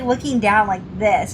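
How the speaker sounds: normal talking voice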